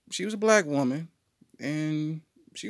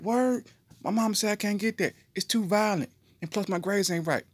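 put-on high whiny kid; 9 semitones higher than usual. Boy voiced complaining.

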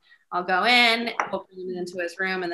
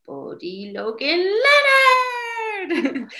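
high, long drawn-out yell of a name; imitated child's yell; 13 semitones higher, 7 dB louder, much slower than usual. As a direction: holler it like a proud kid at the mirror. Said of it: "He'll just yell it in the mirror".

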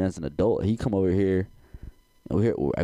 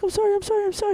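high, squeaky frantic "I'm sorry"; mock pleading apology; much faster than usual. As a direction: plead like a caught, panicked girl. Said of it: "She was like".

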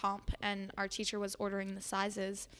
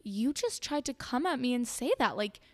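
stunned disbelief, retold; in awe; 4 semitones higher, 4 dB louder, slower than usual. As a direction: act out being floored by the nerve of it. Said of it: "I was, like, in awe".

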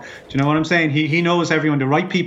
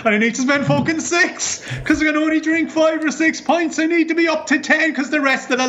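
worked-up; 11 semitones higher than usual. Acted. Complaining about pub rivals.

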